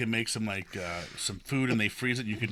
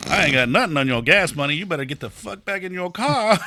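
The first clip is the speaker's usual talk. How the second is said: loud, raised-pitch telling-off; retold angry retort; 7 semitones higher, 9 dB louder than usual. Retelling how he sent the panhandler away, angry retort. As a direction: tell him off loudly, acting it out.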